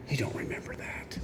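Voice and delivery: whispered aside to a friend; teasing whisper to Will. Real, not acted. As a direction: drop to a whisper, just for him.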